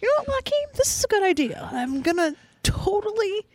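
high-pitched mock agreeable voice; put-on high, gullible enthusiasm; mocking co-host impression. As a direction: imitate a friend naively talking himself into it. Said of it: "and you were like".

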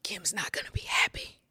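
real whisper, barely voiced; whispered aside. Real, not acted. Aside that Kim won't like this, whispered. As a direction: drop to a soft whisper. Emotional aside after a pause.

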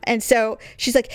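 normal talking voice

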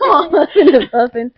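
laughing through the words; giggling while still winded. Real, not acted.

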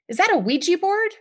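high, loud shocked cry; startled outburst. A narrator's reading. Mother catching the kids at a Ouija board, loud shocked cry.

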